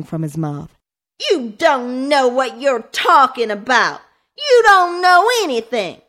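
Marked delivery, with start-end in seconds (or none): 1.3-6.0 furious through tears. A narrator's reading. Boy raging at his sister through tears. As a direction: scream it while crying. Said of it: "Tears streamed down his face".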